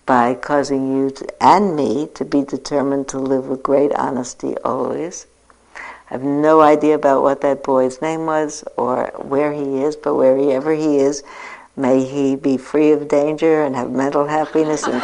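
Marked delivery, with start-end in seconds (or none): none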